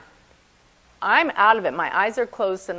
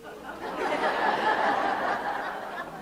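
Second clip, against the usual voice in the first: breathy laugh; 12 semitones higher, 5 dB quieter than usual. Real, not acted. Tells a funny proof.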